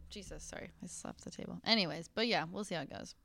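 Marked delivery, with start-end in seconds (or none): 0.1-1.6 unvoiced quiet aside; whisper. Real, not acted. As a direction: whisper the apology. Aside after slapping table.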